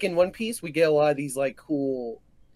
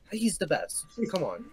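ordinary talk, nothing marked

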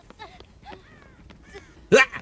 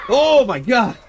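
very high shouted laugh; laugh-scream at the scare; shrieking laugh; 13 semitones higher, 4 dB louder, slower than usual. Real, not acted. Gamer jump-scared, shrieking laugh then loud cry.

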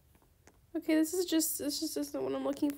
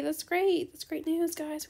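ordinary delivery

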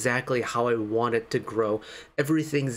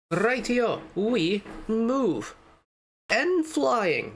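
excited; 9 semitones higher, much slower than usual. Real, not acted. Switches to next song, perked up.

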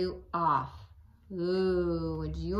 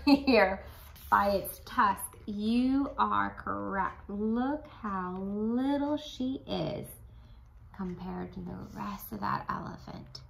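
usual speaking voice

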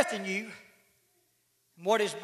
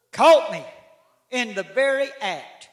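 "Caught me" thrown out loud; "Caught me" punched, loud, falling; sudden emphatic outburst; 9 dB louder than usual. Acted. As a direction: hit "Caught" hard and loud. Repeated, second time bursts out.

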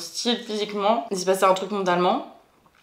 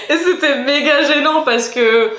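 laughing; 6 semitones higher, 7 dB louder, slower than usual. Real, not acted. Embarrassing story, laughing.

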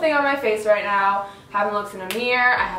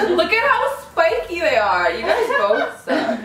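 higher voice broken by laughter; laughing dismay; 5 semitones higher than usual. Real, not acted. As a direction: complain through real giggles. Laughing at bad eyelash job.

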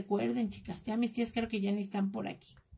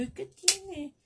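retold protest; 8 semitones higher, much slower than usual. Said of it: "Y yo".

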